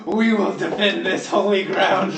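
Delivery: slow, solemn vow in character; drawn-out heroic pledge; resolute holy warrior. Acted. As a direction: declare it grandly, taking your time. Player speaks as defiant warrior.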